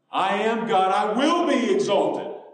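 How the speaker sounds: high, loud, drawn-out declaration; booming proclamation. Acted. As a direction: proclaim it loudly as God. God's proclamation, suddenly loud.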